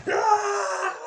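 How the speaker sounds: loud, high yell; hammed-up wrestler's roar; imitated battle scream. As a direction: scream as if shrugging off a hit. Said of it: "they'd be like".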